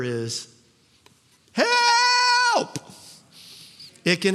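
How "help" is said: desperate cry for help. Acted. Preacher cries out the prayer for help. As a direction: call out like you're drowning.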